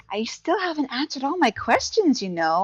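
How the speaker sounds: high, swooping playful voice; mischievous, flirty high-pitched wheedling; tipsy, grinning tease. Acted. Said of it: "I grin at him and he goes".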